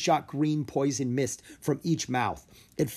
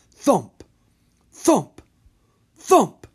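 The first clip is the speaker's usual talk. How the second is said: each thump louder and higher; booming thumps, building; 11 semitones higher, 11 dB louder, much slower than usual. A narrator's reading. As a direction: footsteps growing closer. Giant's footsteps approaching.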